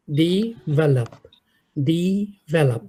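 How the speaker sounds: "ডেভেলপ" said slowly with a short i then a clear e; "ডেভেলপ" with di-VE-lop, slow and clear; correct pronunciation demo. Shown as a demonstration. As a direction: model "ডেভেলপ" carefully, syllable by syllable. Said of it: "একটা ডয় রস্বইকার, ভয়কার".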